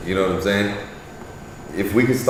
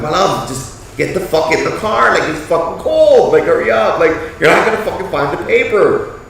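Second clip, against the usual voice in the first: loud, raised calling voice, a bit higher; yelling at wife; 8 semitones higher, 5 dB louder than usual. Retold or imitated. Husband urging wife into the car from the cold, raised yell. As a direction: hurry her up, loud and high. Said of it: "I was just yelling out to her".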